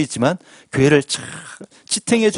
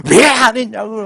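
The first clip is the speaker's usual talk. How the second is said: imitated grandmother's loud, high-pitched telling-off; loud mock outburst of annoyance; angry scolding; 8 semitones higher, 5 dB louder than usual. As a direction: mimic an old woman losing her temper. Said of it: "막 얼마나 역정을 내는지".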